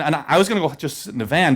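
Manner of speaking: ordinary talk, nothing marked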